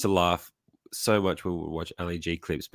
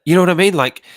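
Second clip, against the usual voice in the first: emphatic plea; 10 semitones higher, 12 dB louder than usual. Real, not acted. Pleading for agreement.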